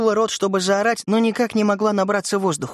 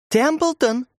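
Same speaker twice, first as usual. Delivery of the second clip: higher-pitched call of a name; lightly raised summoning call; 6 semitones higher than usual. A narrator's reading. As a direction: call the boy in a lifted voice. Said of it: "позвала Урсула".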